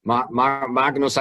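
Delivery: ordinary talk, nothing marked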